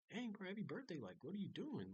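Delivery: high-pitched, animated birthday wish; enthusiastic. Retold or imitated. Congratulating the maintenance man on his birthday, enthusiastic. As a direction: re-enact an enthusiastic reaction, pitch up. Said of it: "he tried to match my tone".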